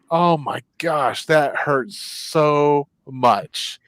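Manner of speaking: very slow, aching inner voice; remembering the pain of losing Charlie. Retold or imitated. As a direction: let the hurt drag. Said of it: "I was thinking".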